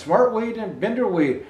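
ordinary delivery, nothing marked

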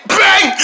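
loud high "Bang!" sound effect; punch sound. Acted. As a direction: shout the punch noise. Punching the crate.

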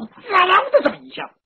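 loud, high-pitched sizzle of catching fire; storyteller's vivid sound effect; sizzle imitation. A narrator's reading. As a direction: imitate the flare-up with a sudden high burst.

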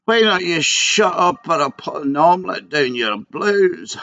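Billy Connolly impression. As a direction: deliver it like Billy Connolly, slowly. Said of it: "That's a Billy Connolly".

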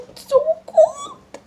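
high wailing cry; crying from cold. Real, not acted. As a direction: whimper it high and pained. Holding snow, cries out.

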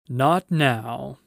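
loud, assured lion; Aslan. A narrator's reading. Lion answering Lucy's question, loud and assured. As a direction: answer in the lion's great voice. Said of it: "said Aslan".

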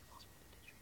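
real hushed whisper. Real, not acted. Whispering an aside to a friend for tissues. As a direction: whisper it barely audibly. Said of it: "if you hear me whispering".

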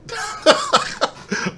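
squeaky breathless giggle; cracking up at his own joke; airy high cackle; high wheezy laugh. Real, not acted. Laughs after his insult.

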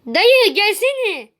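drawn-out call to an uncle; slow, long-vowelled beckoning call. Real, not acted. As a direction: stretch the words to summon someone over. Calling to uncle in the game.

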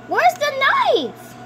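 high, drawn-out startled question; mock alarm. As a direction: ask in play-acted alarm. Acted shock at missing knife.

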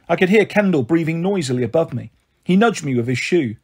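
ordinary delivery, nothing marked

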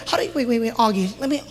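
soft, low mock complaint; half-hearted imitation of an annoyed fan; imitating an annoyed person. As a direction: mutter the question like someone put out. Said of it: "So people get mad".

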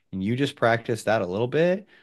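ordinary talk, nothing marked

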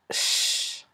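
Ch held as a long shhh; isolated speech sound. Shown as a demonstration. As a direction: sustain Ch alone, a pure hush. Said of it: "on avait vu le son ch". (le son Ch tout seul, prolongé)

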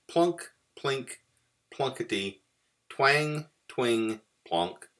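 plucky banjo noises, starting high and stepping down; imitated banjo strumming. A narrator's reading. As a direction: pluck each word like a tiny banjo string. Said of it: "Mouse strummed heartily".

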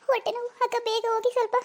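high, tearful pleading through pain; woman in labour crying to her sister-in-law, high and tearful. Acted. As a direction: beg through tears of pain. Labour pains in the car.

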